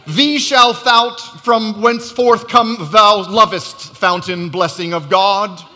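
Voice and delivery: slow, loud, mock King James English; King James accent. Shown as a demonstration. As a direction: ham up old Bible English slowly. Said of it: "say it with a King James accent".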